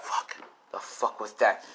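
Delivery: hushed curse. Acted. Villain startled by thrown hat.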